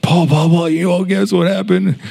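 high-pitched, excited name-calling; excited news. Retold or imitated. Hostess running up to coworker at work, excited. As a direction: imitate a friend bursting to tell, pitch way up. Friend bursting with news a week later.

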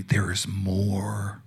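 slow hushed whisper; whispered promise. Real, not acted. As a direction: lean in and whisper it to the congregation. Preacher urging the congregation.

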